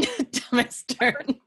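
slow mock drawl. Drawn-out mockery.

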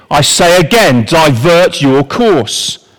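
a captain repeating an order over the radio, insistent; stern navy captain. Retold or imitated. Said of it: "The American says".

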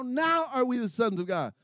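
ordinary delivery

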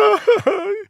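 high, loud villainous cackle; stepsister's evil cackle; wicked cackle. Acted. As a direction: cackle like a scheming sister. Stepsisters laugh.

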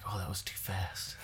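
said softly, as if to oneself; a quiet aside of private regret; retold inner thought. Stoned man thinking about the door, quiet inner thought. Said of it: "I remember even thinking".

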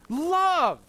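high, loud "Love" urging repeat. Shown as a demonstration. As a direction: sing it out so they echo. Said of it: "Say it out loud with me".

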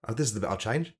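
ordinary talk, nothing marked

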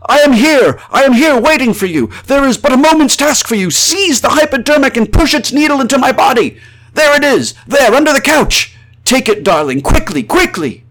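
high, loud, desperate plea; frantic high-pitched shouted appeal; crying out. A narrator's reading. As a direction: cry out urgently to a loved one. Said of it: "I cried out to her".

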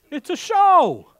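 "show" hit high and loud, slowly; emphatic punchline. Real, not acted. Preacher teasing the congregation about a TV show, high loud emphatic. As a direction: drive home the word "show". Mocking his tears over TV.

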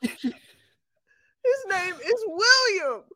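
slow, laughing half-cry; laughing shout. Real, not acted. Telling her friend the punchline, laughing and crying out. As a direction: stretch it out, cracking up.

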